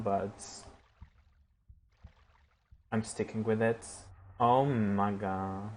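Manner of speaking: ordinary talk, nothing marked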